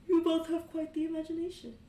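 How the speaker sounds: put-on a mother humoring the kids; amused mother. Kane's mother humors the children, high and loud.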